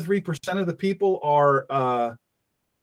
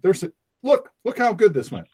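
normal talking voice

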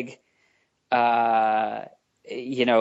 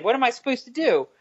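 mock whiny; 7 semitones higher than usual. Mock panic over rebooted universe.

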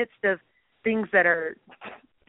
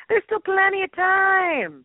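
high, slow, drawn-out exclamation; comic high voice; 8 semitones higher, much slower than usual. Acted. Reassuring friend about their music video, comic high drawl. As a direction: stretch it out in a put-on high voice.

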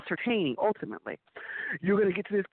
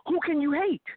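emphatic question; 11 semitones higher than usual. Real, not acted. Rhetorical question repeated for emphasis.

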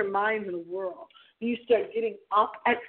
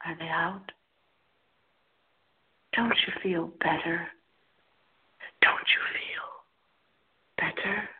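whisper; 3 semitones lower, 4 dB quieter than usual. Acted. Spooky story, lights out.

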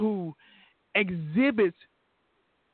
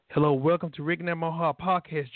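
ordinary delivery

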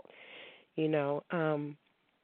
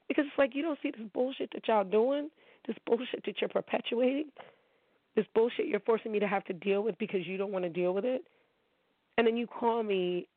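pitched up and heated, fed up; higher-pitched exasperated complaint; exasperated rant; 4 semitones higher than usual. Real, not acted. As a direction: vent the frustration in a high, rising voice. Ranting about men's behaviour.

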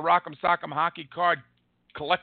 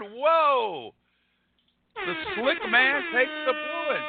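high, drawn-out announcer's call; slow, high-pitched hype call; excited announcer; 8 semitones higher, slower than usual. Acted. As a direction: stretch out the big reveal like an announcer. Announces a winning card pull.

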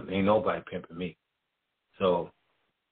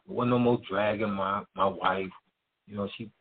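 normal talking voice

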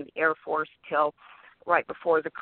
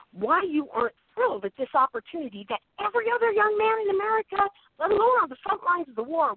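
exasperated demand; 13 semitones higher than usual. Retold or imitated. Owen demands why the kid turns down stardom, high and exasperated. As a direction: voice climbs high in disbelief.

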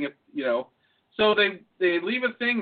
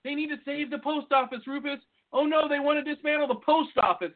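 mock earnest concern; 7 semitones higher than usual. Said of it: "the guy who's like".

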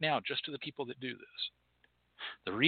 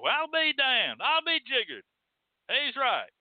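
high, drawn-out mock astonishment; hammed-up country amazement, pitched way up; amazed country mechanic; 9 semitones higher than usual. Country mechanic finding the manual is right, mock amazed.

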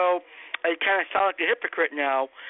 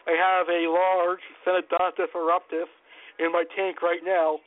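normal talking voice

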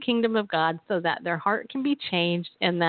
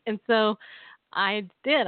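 ordinary talk, nothing marked

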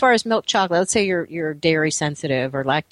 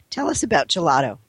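normal talking voice

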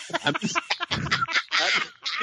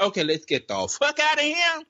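high, urgent fleeing survivor; scared survivor; 9 semitones higher than usual. Retold or imitated. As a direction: bail out in a panic. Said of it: "instead of your first notion".

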